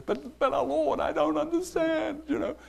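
a small helpless plea upward; bewildered Daniel. Acted. Daniel pleading with the Lord in confusion, high and soft.

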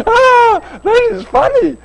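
high, breaking into real laughter; laughing. Real, not acted. Preacher cracking up at his favourite Bible joke, laughing high. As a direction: let the laugh carry the words up high.

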